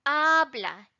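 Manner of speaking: "Habla" with the opening "Ha" emphasized; stress on first syllable. Shown as a demonstration. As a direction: punch "Ha", let "bla" fall away. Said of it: "It's in this first syllable".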